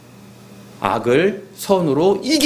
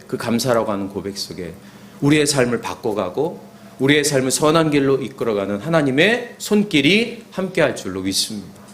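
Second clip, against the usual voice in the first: ordinary talk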